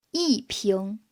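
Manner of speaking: "一" falling sharply before rising "瓶"; fourth-tone yi. Shown as a demonstration. Teacher making the rule clear, "一" falling before "瓶". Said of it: "it changes into the fourth tone".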